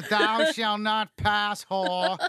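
loud, slow wizard proclamation; hammed-up commanding wizard; Gandalf. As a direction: boom it out like a wizard barring the way. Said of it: "like Gandalf".

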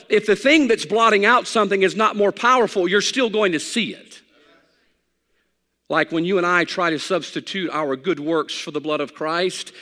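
ordinary talk

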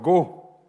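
"go" set apart and stressed; emphasis on GO. Shown as a demonstration. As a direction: lean on the lone word "go". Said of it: "put the emphasis on the word".

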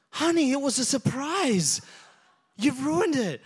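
mock dismay at the spoiled surprise; [name], scolding. Wife tells daughter she ruined the surprise, mild mock scolding.

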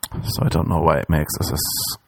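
ordinary talk

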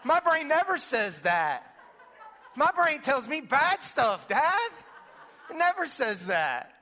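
put-on laughing child. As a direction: play the giggling son. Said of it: "He said".

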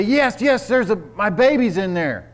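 put-on high, loud plea about the baby; frantic mother. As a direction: beg him to save the baby. Mother pleading about her baby.

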